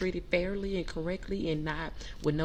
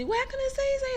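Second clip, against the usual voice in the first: very high sing-out store greeting; imitated staff call, pitched way up; hollered welcome. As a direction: holler a welcome across the shop. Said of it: "They be hollering out".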